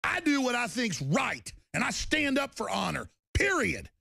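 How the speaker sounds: high-pitched, ringing conviction; righteous and worked-up; defiant declaration. Real, not acted.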